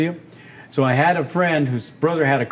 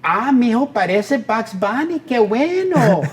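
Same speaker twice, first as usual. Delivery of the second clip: mom; 8 semitones higher than usual. Retold or imitated. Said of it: "My mom, she goes".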